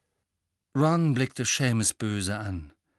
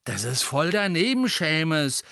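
warning disapproval; 5 semitones higher, 4 dB louder than usual. A narrator's reading. Ron reproving his roommate Seamus, warning disapproval. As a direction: warn him with restrained annoyance. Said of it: "dessen Ohren inzwischen rot glühten, immer ein Zeichen von Gefahr".

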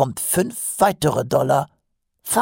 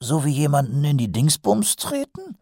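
higher, curious questioning corporal; interested; 8 semitones higher than usual. A narrator's reading. Corporal asks recruit where to kick a dragon, interested. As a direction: ask with growing interest. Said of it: "fragte Nobby interessiert".

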